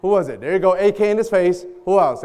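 ordinary talk, nothing marked

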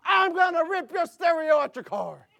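high, loud shout from the pulpit; yelling pastor. Retold or imitated. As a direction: imitate a pastor bellowing at the congregation. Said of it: "the pastor yelling behind the pulpit".

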